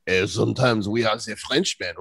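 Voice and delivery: mock French accent, ze instead of the; lightly hammed-up French accent. Host joking about the show's accents in a mock French accent. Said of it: "We use, we use all the accents".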